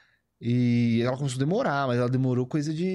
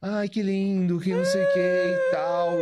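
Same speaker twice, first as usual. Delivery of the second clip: imitating her moved, higher-pitched gush; mock-touched coo, pitched up; emoção imitada; 4 semitones higher, slower than usual. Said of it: "ela ficou emocionada". (comovida, em imitação aguda)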